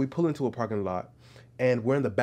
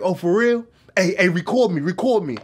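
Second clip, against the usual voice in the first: loud, high, insistent mimicry; imitated eagerness; 8 semitones higher, 7 dB louder than usual. Stranger urges the cameraman to film him, mimicked loud and eager. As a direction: beg for the camera like the excited stranger. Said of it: "And he was like".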